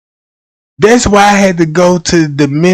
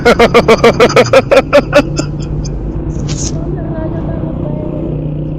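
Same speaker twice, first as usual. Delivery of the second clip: high wheezing laugh; 8 semitones higher, 5 dB quieter than usual. Real, not acted. Cracking up at his skit.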